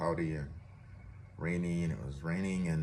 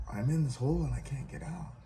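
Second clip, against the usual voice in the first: high-pitched mock distress; higher, helpless complaint in storytelling; retold plea; 8 semitones higher than usual. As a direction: plead from the hole, lightly acted. Trapped man pleads with religious person.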